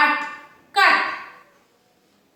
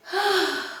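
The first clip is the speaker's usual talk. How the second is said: demo sigh; 2 semitones higher, 5 dB quieter than usual. Shown as a demonstration. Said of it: "When you are tired and you take a long breath".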